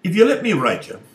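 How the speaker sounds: high, loud sly offer; leprechaun voice. Acted. Leprechaun makes his offer, high and sly. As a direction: pitch way up, tiny and sneaky.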